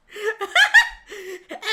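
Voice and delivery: loud, high giggle; laugh. Real, not acted. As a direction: burst out giggling. Laughs at own country act.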